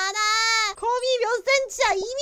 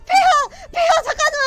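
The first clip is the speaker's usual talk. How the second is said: high, loud panicked cry after the king; calling shout; 6 semitones higher, 11 dB louder than usual. Real, not acted. Player shouting after the king to wait, loud and high. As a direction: yell to stop him leaving.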